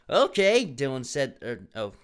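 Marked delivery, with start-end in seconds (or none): 0.1-0.6 bright, loud, upbeat okay; "Okay" sung out happily and loud; eager agreement; cheerful okay. Shown as a demonstration. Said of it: "Dylan's depressed here".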